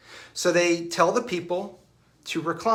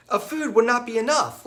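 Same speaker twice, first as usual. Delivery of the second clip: a panicked disciple caught off guard; flustered Philip; 10 semitones higher, 3 dB louder than usual. Retold or imitated. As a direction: Philip overwhelmed by the size of the crowd. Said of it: "Philip obviously takes him very serious".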